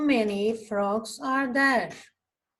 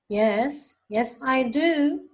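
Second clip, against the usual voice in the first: usual speaking voice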